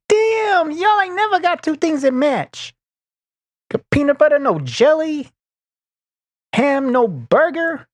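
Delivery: [name] impression. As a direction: ham up an [name] line from Friday, high and loud. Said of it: "I'm like [name]".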